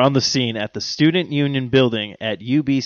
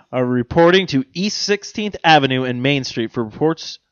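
ordinary delivery

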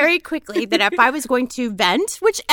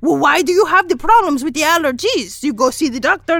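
higher, louder scolding father; nagging dad; 4 semitones higher, 4 dB louder than usual. Retold or imitated. Dad on the phone scolding Monique, loud and high. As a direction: nag her like a worried dad.

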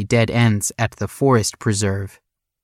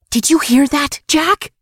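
put-on high, urgent little-girl whisper-scream; whisper-scream it like an excited little sister; stage whisper; 4 dB louder than usual. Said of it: "she whisper screamed".

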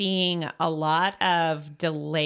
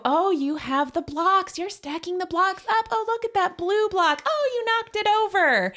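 parallel talk demo; 13 semitones higher than usual. Shown as a demonstration. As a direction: narrate a child's play sweetly. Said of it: "parallel talk where you're talking about what your kid's doing".

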